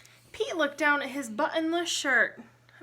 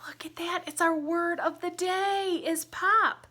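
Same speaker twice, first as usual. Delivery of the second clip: excited whisper; 6 semitones higher than usual. Acted. Gasp and secret to children.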